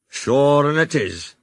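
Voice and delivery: slow, lightly voiced Irish guard. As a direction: drawl it out teasingly. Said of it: "began the guard".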